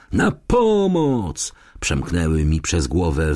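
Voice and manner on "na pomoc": high, very drawn-out cry; child's scream for help. A narrator's reading. Child's cry echoing in the narrator's head, wailed high. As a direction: stretch it into a distant, desperate call. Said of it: "Jest coś strasznego w krzyku dziecka".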